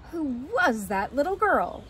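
lightly voiced curious cub; Baby Bear. Baby Bear asks about the runaway girl, little bear voice. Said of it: "asked Baby Bear".

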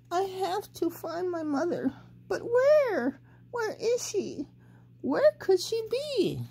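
high, searching little bird voice; a lost chick wondering aloud; worried baby bird. A narrator's reading. Baby bird searching for his mother, high and anxious. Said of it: "said the little baby bird".

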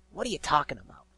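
loud, scornful question. A narrator's reading. Griffin questioning the unseen voice, scornful. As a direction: challenge it with scorn. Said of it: "asked Gilda scornfully".

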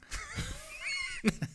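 shrieking laugh, very high; host cracks up at his own question. Real, not acted. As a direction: burst into a high squealing laugh.

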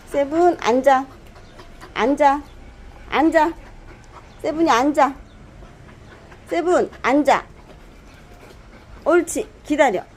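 talking to a dog. Real, not acted. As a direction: drill the dog firmly on sit. Repeating sit command to dog.

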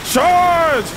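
high, drawn-out commander's shouts; rally the troops with hammed-up war cries; mock battle cry. Player leading a rush in game, mock commander shouting.

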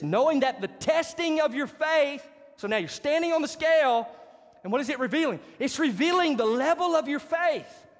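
high, forceful preacher's declamation; emphatic preaching. Real, not acted. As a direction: proclaim it to the whole congregation. Preacher declaims scripture and application.

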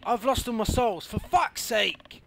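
loud, high, slowing to a drawn-out curse; furious shout. Real, not acted. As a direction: rage at losing everything. Player lost all souls.

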